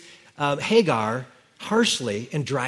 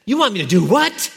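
higher, louder incredulous question; mock indignant disbelief; 6 semitones higher, 4 dB louder than usual. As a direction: play the outraged servant. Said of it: "She didn't say".

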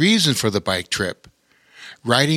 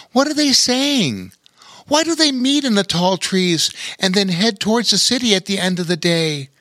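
put-on curious boy; 8 semitones higher than usual. Lore's voice.

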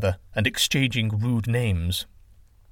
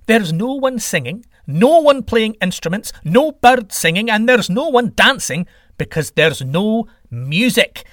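high, loud exasperated rant; fervent plea, slowing on the final line; impassioned sky god; 9 semitones higher, 6 dB louder than usual. Acted. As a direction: list the miseries with mounting urgency. Sky god pleads for music.

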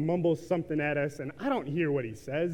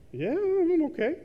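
surprised stranger; 11 semitones higher, 3 dB louder than usual. Retold or imitated. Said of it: "He was kinda like".

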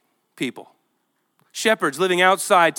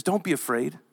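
soft, low and gentle; quiet reassuring angel; tender reassurance; 5 semitones lower, 5 dB quieter than usual. Acted. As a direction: comfort them like a frightened child. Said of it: "But he says".

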